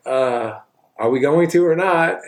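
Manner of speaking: a mocking high voice for an impatient girl, up in pitch; impatient girlfriend. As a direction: toss it off, fed up and waiting. Impatient girl in retold scene.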